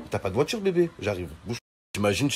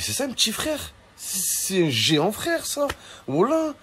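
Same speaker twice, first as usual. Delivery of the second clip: much higher, slowed, squeaky astonishment; stunned disbelief; 12 semitones higher than usual. Pretend. Facing her two-metre little brother, squeaky disbelief. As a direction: gasp it out at the giant.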